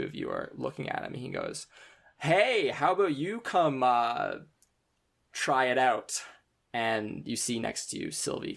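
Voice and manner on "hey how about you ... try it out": loud, taunting call across the room; cocky challenge. Acted. Upperclassman calling the players over to spar. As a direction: dare them to come spar.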